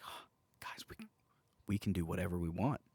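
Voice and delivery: ordinary talk, nothing marked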